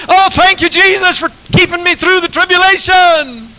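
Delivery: very high, loud jubilant shout; preacher acting his own shout at camp meeting, very high and loud; mock shouting praise. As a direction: shout it out like a revival. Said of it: "And I'm shouting".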